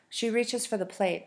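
ordinary delivery, nothing marked

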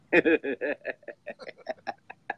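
long run of chuckles after own joke; laughing. Real, not acted. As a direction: break into a drawn-out, rhythmic laugh. Laughs at own joke about hero.

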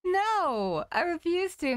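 high, loud, drawn-out no; whiny refusal. Real, not acted. Player refusing to do it all over again, high whiny protest.

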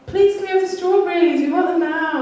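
put-on high, loud whining child; whiny kid voice. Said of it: "in a whiny voice like that".

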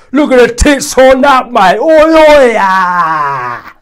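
loud, high yobbish jeer; hammed-up lads' catcall; mock leering lad. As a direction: jeer it at her like a lout. Mocking lads leering at a woman.

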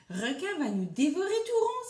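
high-pitched storybook panic; acted alarmed wail; crying out. A narrator's reading. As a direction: cry it high, like frightened prey. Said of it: "s'écria Méduse".

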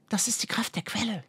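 high, airy, awed whisper. A narrator's reading. Girl sensing the spring's power, whispering. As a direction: whisper it softly in wonder. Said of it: "flüsterte Laria".

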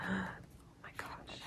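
breathless whispered oh my gosh; hushed whisper; awed whisper. Real, not acted. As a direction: whisper it as if in awe of the photos. Awed by photo book pages.

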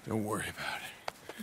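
soft, low whisper; quiet reassuring whisper. Acted. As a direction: hush it so nobody hears. Hushed talk by the others.

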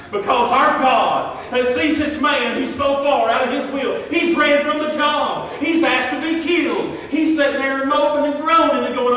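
loud, high, impassioned preaching; full-force preaching. Real, not acted. Preacher exhorts church about God's mercy, full force. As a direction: shout the praise to the congregation.

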